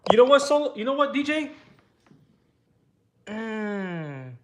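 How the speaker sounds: high, loud playful ribbing; gloating mock payback; teasing. Real, not acted. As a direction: razz your buddy for laughing at you. Teasing DJ on stream.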